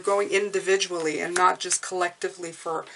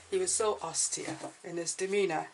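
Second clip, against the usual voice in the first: bad English accent; 9 dB quieter than usual. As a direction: read it like a hammed-up English gentleman. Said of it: "a very bad English accent".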